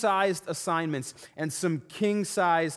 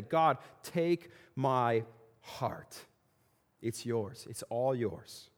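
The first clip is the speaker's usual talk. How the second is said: slow surrender prayer; 6 semitones lower, 5 dB quieter, slower than usual. Pretend. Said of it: "how many of us are willing to say that".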